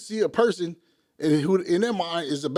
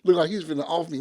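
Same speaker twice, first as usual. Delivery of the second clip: normal talking voice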